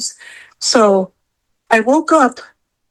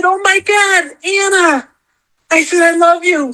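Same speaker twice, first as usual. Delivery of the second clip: overwhelmed greeting; 6 semitones higher than usual. Retold or imitated. Retelling meeting her dead idol.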